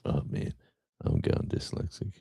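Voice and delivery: breathless whisper to himself; self-mocking whispered aside. Real, not acted. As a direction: whisper the complaint under your breath. Muttering after misreading the name.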